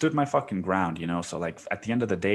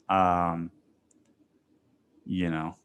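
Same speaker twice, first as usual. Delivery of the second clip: ordinary talk, nothing marked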